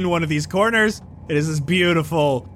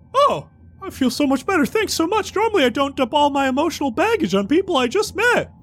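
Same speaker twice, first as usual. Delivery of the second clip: relieved character voice; 11 semitones higher than usual. Acted. Relieved bag NPC.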